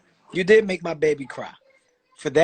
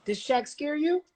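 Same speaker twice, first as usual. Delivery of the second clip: gentle coaxing; 7 semitones higher, 6 dB quieter than usual. Real, not acted. Father checking whether Shaq scared his son, gently coaxing.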